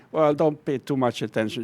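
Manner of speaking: ordinary talk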